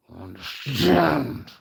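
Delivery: slow, loud, harsh roar; menacing roar. Real, not acted. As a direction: roar it, dragging out the last word. Threat repeated with force, knife in hand.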